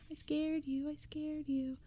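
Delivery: put-on high, sing-song gloating kid voice; taunting little sister. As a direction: crow over the scare like a seven-year-old. Girl jumps out, taunting brother.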